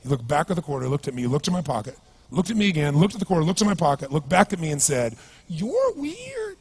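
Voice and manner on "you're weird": very high, drawn-out squeal; comic high-pitched drunk verdict; bizarre drunk voice. Retold or imitated. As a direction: imitate the weird drunk, way up high. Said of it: "really bizarre looking sounding voice".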